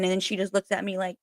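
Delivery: ordinary talk, nothing marked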